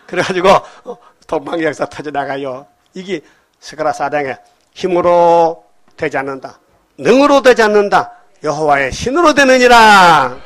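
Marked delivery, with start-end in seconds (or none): 4.7-10.4 building scripture proclamation. Acted. Preacher proclaiming Zechariah chapter four to the congregation, building to a shout. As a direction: proclaim the verse louder with each phrase, stretching the last.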